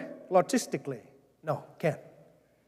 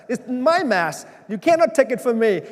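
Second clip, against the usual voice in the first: louder, higher possessive protest; half-playful outraged churchgoer defending their time slot; mock indignant parishioner; 5 semitones higher, 6 dB louder than usual. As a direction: play a parishioner clinging to their mass. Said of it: "our people like to go to their mass".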